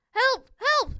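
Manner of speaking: high, loud calls for help; a boy's alarmed plea; cry for help. A narrator's reading. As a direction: call out urgently in short bursts. Boy phones police in panic.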